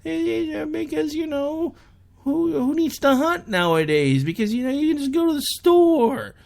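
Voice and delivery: high-pitched mock know-it-all; put-on voice ridiculing the common opinion; mocking naysayer. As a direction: parrot a silly argument in a high, exaggerated voice. Mocking what everybody supposedly thinks.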